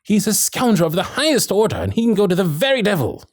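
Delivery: high-pitched, heated outrage; indignant outburst. Acted. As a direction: condemn the villain with rising indignation. Indignant outburst against the blackmailer.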